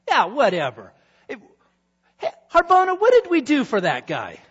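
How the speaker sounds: loud, high, offhand king; dismissive king. Acted. King waving off the correction, loud and high. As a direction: brush it off and call out.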